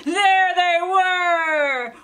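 loud, high and very slow exclamation; dramatic reveal. A narrator's reading. Narrator springing the scare on young listeners, slow loud cry. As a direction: stretch it out like a jump scare.

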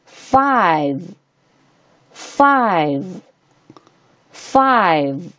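five with a long, drawn-out eye vowel; letter-name I sound. Shown as a demonstration. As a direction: say five slowly, stretching the I. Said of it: "在这里发本声音I".

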